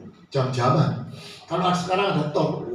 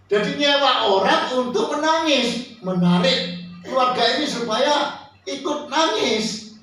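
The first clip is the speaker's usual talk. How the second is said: emphatic preaching; 6 semitones higher than usual. Real, not acted. Preacher explains hired mourners to congregation, raised emphatic voice. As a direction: preach it out, stretching toward the end.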